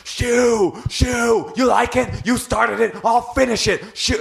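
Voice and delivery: high-pitched shouted imitation of a man losing it; mock screaming. Boyfriend runs over enraged at the dog owner, mock screaming. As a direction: scream it way up high, hammed-up and ballistic. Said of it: "starts screaming".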